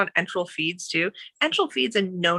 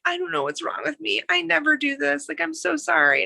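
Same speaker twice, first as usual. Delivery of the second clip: higher, embarrassed apologizing patient; an embarrassed patient apologizing to the nurse, higher voice; apologetic patient; 9 semitones higher than usual. Retold or imitated. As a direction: voice a patient mortified and sorry. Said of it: "they're like".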